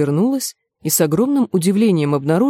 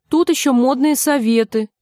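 higher-pitched, mildly huffy reply; sulky protest; offended; 6 semitones higher than usual. A narrator's reading. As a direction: answer a little hurt, defending your interest. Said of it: "обиженно отозвалась Джульетта".

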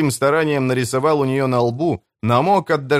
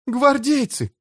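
moaning in fear; 13 semitones higher, slower than usual. Acted. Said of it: "простонала Кэтрин".

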